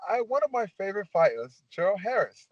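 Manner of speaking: high, put-on British fan voice; British accent. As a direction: play the prank caller in a mock British accent. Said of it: "I got a British accent".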